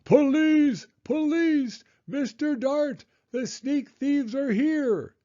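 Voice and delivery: loudest, highest yell for help; terrifying shout. Acted. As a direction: scream out to call the police. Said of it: "he shouted in his loudest, most terrifying voice".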